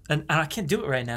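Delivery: ordinary talk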